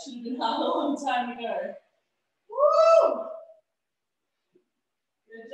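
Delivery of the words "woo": high, loud cheering whoop; excited whoop. Real, not acted. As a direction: let out a big whoop. Cheering through the workout.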